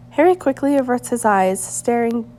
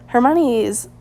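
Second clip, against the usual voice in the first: usual speaking voice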